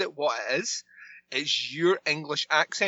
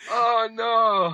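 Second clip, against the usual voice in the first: mock despair; 5 semitones higher, 6 dB louder than usual.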